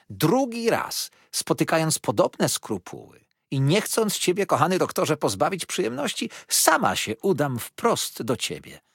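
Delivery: sugary, insistent flattery of the doctor; Mrs Markleham. A narrator's reading. (przesłodzona, natarczywa teściowa)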